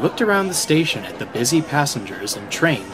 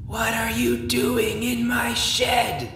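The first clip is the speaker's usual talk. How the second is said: slow, flat spooky drone; low monotone voice; 11 semitones higher, slower than usual. Acted. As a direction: drone it like a ghostly warning. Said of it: "in a low, monotone voice".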